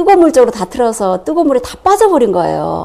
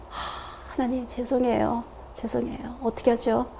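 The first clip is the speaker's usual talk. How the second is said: hushed repentant prayer; 14 dB quieter than usual. Retold or imitated. Quick repentance prayer to God.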